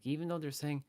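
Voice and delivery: normal talking voice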